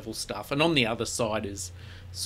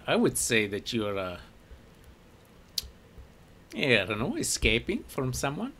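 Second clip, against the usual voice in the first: knowing shopkeeper; 3 semitones higher, 4 dB louder than usual. Acted. Shopkeeper sizing up the drow newcomers, in a sly, knowing voice. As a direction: muse shrewdly on the strangers.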